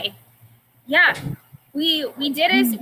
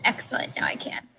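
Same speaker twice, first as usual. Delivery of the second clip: scared breathless whisper; bugbear; 9 dB quieter than usual. Acted. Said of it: "the bugbear is terrified".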